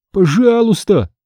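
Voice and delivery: high, drawn-out plea; begging. Acted. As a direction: beg with a choking throat. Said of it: "умолял я". (умоляюще)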